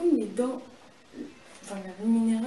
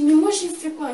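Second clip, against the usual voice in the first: mild mock cry, louder and higher; frightened protest; 7 semitones higher, 11 dB louder than usual. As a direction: plead the question in alarm. Frightened protest to the whipping spirit.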